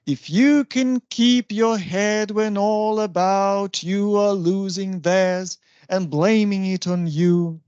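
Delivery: slow, measured verse with every second syllable stressed; iambic recitation. Shown as a demonstration. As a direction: recite "If you can keep your head" weak-STRONG, slowly. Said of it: "This is I am".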